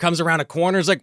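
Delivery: ordinary talk, nothing marked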